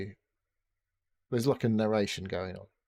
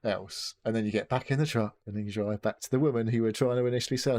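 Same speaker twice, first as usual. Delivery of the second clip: normal talking voice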